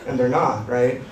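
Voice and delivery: usual speaking voice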